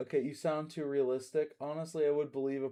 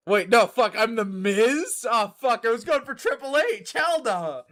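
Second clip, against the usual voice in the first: startled outburst; 8 semitones higher, 8 dB louder than usual. Real, not acted.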